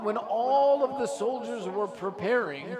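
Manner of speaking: usual speaking voice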